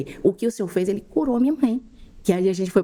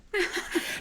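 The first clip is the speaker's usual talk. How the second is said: teary laugh; 13 semitones higher, 8 dB quieter than usual. Real, not acted. Trying not to cry.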